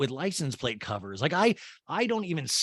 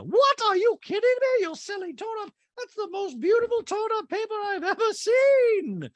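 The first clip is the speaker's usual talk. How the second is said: Dorit voice; 3 dB louder than usual. Retold or imitated. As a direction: gush it in a high, loud mock-posh voice. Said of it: "And Dorit goes".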